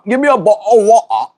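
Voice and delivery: high mock-British with dropped t's; British accent. Shown as a demonstration. Streamer showing how the British one goes, mock British accent. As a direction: ham up a glottal-stop British accent. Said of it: "that's the, the British one".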